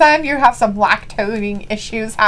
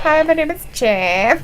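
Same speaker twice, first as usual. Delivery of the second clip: awkward, constipated fake Spanish accent; mock Spanish accent. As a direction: imitate a bad Spanish accent, rising at the end. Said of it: "didn't say it like that, but I had to".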